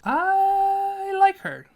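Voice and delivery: high, loud, stretched-out squeal; delighted squeal. Real, not acted. Ranker reaching a character they like, delighted squeal. Drawn-out squeal over a favourite.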